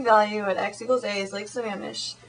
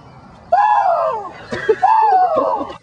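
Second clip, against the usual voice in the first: loud burst of laughter; 10 dB louder than usual. Real, not acted. Cracks up after the bit.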